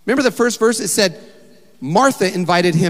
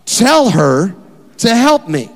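loud, higher, clipped command; bossy order; 6 semitones higher, 7 dB louder, slower than usual. Acted. Martha giving Jesus a direct order, loud and demanding. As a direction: order him like a frazzled host. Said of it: "she gives a direct order to Jesus".

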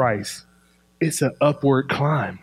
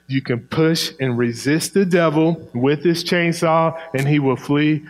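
ordinary talk, nothing marked

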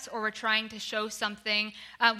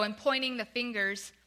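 normal talking voice

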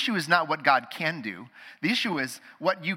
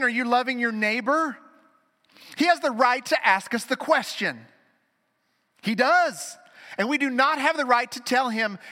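loud, higher preaching voice; fervent preaching; 6 semitones higher, 5 dB louder than usual. Real, not acted. Preacher presses the congregation, loud and fervent. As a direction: drive each question home to the church.

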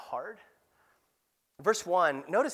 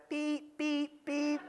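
tiny high, softer beeps; baby imitating beeps; 9 semitones higher, 5 dB quieter, much slower than usual. Baby daughter trying to hurry Mommy, high mimicked beeps. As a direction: mimic a little girl faking the timer. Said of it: "looked up at Mommy and went".

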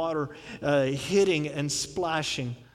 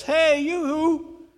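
higher, louder preaching cry; loud calling out; 5 semitones higher than usual. Retold or imitated. Jesus calling the thirsty crowd to come to him, loud. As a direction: call to the whole crowd. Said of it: "he stands up and says".

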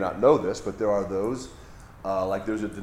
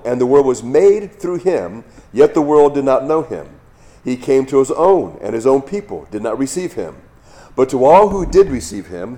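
loud, measured Bible reading to the congregation; scripture reading; 3 semitones higher, 8 dB louder than usual. Acted. Pastor reading John chapter one aloud, solemn and loud. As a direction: read it out like the pulpit lesson.

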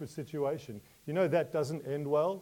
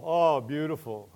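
normal talking voice

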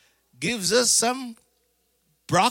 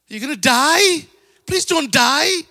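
higher, louder alarmed plea; pleading disciples; 9 semitones higher, 7 dB louder than usual. Retold or imitated. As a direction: beg him not to die.

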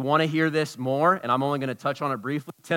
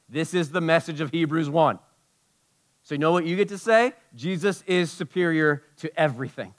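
normal talking voice

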